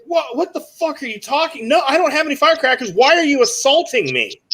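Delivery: high-pitched outraged protest, rushing in the middle; retold indignant protest. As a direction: protest your innocence in disbelief, pitch up high. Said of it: "And I'm getting loud".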